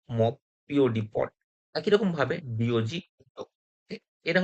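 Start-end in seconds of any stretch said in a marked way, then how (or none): 0.1-1.3 "মব" and "পড" with O said as অ; short-o demonstration. Shown as a demonstration. Teacher showing the O rule with examples, O pronounced as অ in "মব", "পড". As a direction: say O as an open অ in "মব", "পড". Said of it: "ওর উচ্চারণটা হচ্ছে অ".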